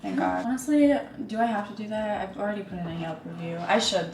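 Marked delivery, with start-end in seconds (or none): none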